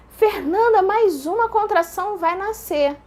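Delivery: high, emphatic, spaced-out announcement; doctor's urging. Retold or imitated. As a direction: press the news on her firmly. Doctor voiced urging her on.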